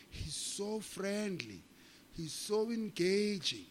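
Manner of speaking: slow, drawn-out praise; admiring imitation. Quoting everyone who talks about Zuma, mock admiring. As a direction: linger admiringly on each word. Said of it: "everyone who talks about Jacob Zuma always says".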